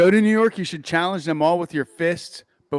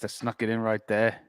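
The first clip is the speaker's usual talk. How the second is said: normal talking voice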